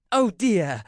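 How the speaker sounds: startled courtier; alarmed oh dear. Acted. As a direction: doors bang open, fluster. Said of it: "Tremaine said".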